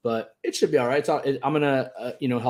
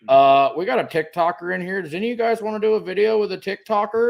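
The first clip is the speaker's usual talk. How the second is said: higher, louder announcing clerk; clerk on radio; 8 semitones higher, 4 dB louder than usual. Retold or imitated. Best Buy clerk asking staff over the radio, higher and louder. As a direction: call it out over the store radio.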